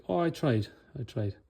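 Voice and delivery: soft, sagging excuse, dropping away on the second; a kid's deflated excuse after the match; defeated whine. Retold or imitated. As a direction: mumble it like a kid who has given up. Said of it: "and then again in his voice".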